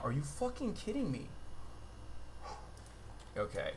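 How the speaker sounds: normal talking voice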